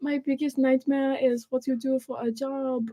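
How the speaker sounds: put-on giggly German-accent parody; mock German accent. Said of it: "sounding like the German accents you're doing".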